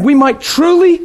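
ordinary delivery

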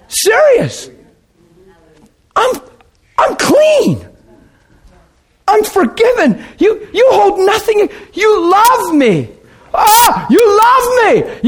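exultant shouting to God; retold ecstatic outburst. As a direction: relive the overwhelming joy. Reliving the night he got saved.

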